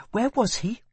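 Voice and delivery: put-on a curious young woman asks; girl's voice. A narrator's reading. Girls voiced in story.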